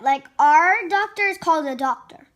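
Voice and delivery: plain reading voice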